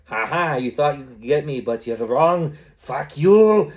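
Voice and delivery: triumphant taunt rising to a loud, high finish; gloat, then belt out the curse; hammed-up victorious trash talk; mock gloating. Gloating at a beaten game opponent.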